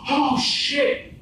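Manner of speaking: high, loud mock shriek of shock; sudden high-pitched yelp; imitated alarmed outburst. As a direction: blurt it way up high, imitating a startled friend.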